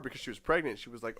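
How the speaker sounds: ordinary talk, nothing marked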